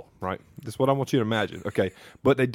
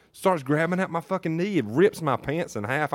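ordinary talk

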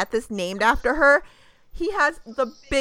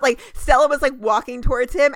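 ordinary talk

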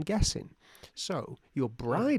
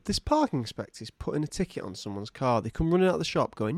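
ordinary talk, nothing marked

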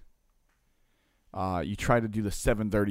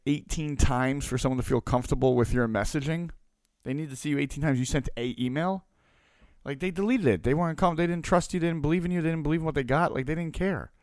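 high-pitched, insistent retold rebuke; imitating a blunt buddy's lecture; rude scolding; 6 semitones higher than usual. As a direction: lay into him point after point, pitched up. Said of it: "that sounds rude".

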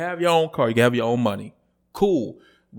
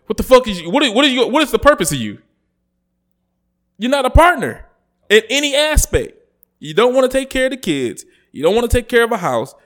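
high, loud exasperated yelling; furious rant; 10 semitones higher, 5 dB louder than usual. Real, not acted. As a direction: blow up at them, fed up. Furious rant at entitled women.

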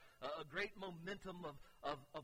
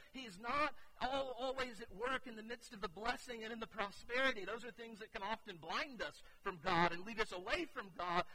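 higher, louder preacher drive; forceful preaching; 5 semitones higher, 6 dB louder than usual. Acted. Pastor pressing the point to the congregation, forceful preaching. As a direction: preach it with rising conviction.

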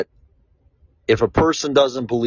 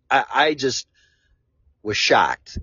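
ordinary talk

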